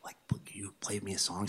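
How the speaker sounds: imitating a weak, whispered request; barely able to talk. Very sick man asking his friend for a song, whispered weakly. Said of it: "he could barely talk at this point".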